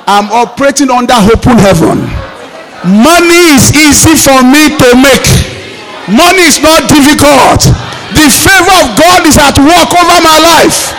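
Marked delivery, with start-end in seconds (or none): 2.8-10.9 preaching at full force. Acted. Pastor to his congregation, preaching at full force. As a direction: lift the congregation, ringing out each line.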